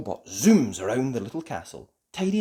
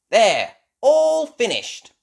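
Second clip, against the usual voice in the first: high, loud, drawn-out finish; triumphant announcement; 10 semitones higher, 10 dB louder, slower than usual. A narrator's reading. Ben showing the fixed robot, high triumphant call. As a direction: declare the job done triumphantly.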